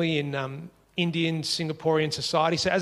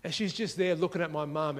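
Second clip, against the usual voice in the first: normal talking voice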